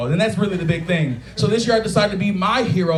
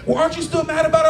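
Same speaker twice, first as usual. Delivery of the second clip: higher, fast needling ex-wife voice; mock pushy ex-wife; 9 semitones higher, faster than usual. Comedian mimics his ex-wife pressing him, fast and needling. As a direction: rattle it off to provoke him.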